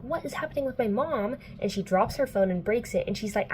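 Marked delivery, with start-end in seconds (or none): 0.0-1.4 higher-pitched retold worry; lightly dramatised alarm; mock freaking out. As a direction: act out a mild panic. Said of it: "freaking out".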